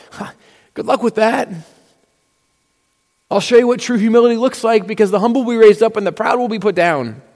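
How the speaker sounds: high, sassy "good luck with that"; mock taunt. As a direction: tease the proud. God voiced mocking the proud.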